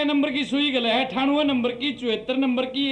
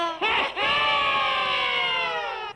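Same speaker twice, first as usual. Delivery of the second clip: high laugh; 12 semitones higher, 4 dB quieter than usual. Real, not acted. Host laughs at joke.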